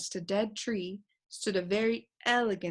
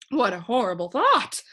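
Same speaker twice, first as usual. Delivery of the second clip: shuddering; 3 semitones higher, 7 dB louder than usual. A narrator's reading. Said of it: "the bird shuddered".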